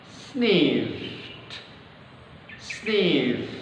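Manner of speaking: "Sniffed" with f then a barely released t; sniffed, t dropped. Shown as a demonstration. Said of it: "seperti kata sniff". ("Sniffed" dengan T di-drop)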